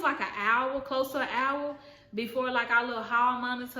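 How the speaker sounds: usual speaking voice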